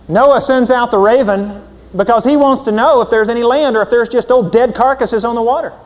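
loud, high preaching voice; fervent preacher building up. Acted. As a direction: drive it home from the pulpit. Preaching at full force.

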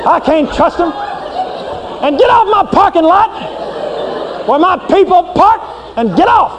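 lightly voiced indignant God; scornful God. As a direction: dismissively order him off the lot. God voice continues.

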